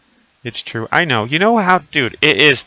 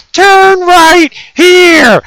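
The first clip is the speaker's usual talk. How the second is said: high, drawn-out mock scream; very loud, slow falsetto yell; imitating GPS yelling; 13 dB louder, much slower than usual. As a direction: shriek the direction like a furious sat-nav. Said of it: "She started yelling at me".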